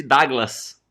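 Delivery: DOUGLAS com "a" aberto, como "Dáglas"; pronúncia local de Douglas. Shown as a demonstration. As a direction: diga Douglas abrindo a primeira vogal em "á". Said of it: "Aí eles chamavam o pastor de". (Douglas pronunciado "Dáglas", à moda local)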